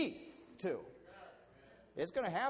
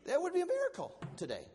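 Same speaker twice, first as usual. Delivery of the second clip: 1 emphatic; 12 semitones higher, 3 dB louder, faster than usual. Real, not acted. Repeat exclamation.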